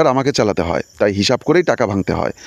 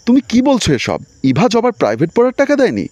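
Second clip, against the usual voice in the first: much higher, disbelieving question; astonished; 11 semitones higher than usual. A narrator's reading. Son hearing his wife cut his sister's tuition, shocked. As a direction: gasp it out in sudden disbelief. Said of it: "আমি অবাক হয়ে বললাম".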